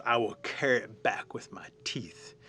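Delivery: slow, whispery creature voice; huge snake. Acted. Snake looks at the fire, slow hushed resolve. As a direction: say it hushed and deliberate.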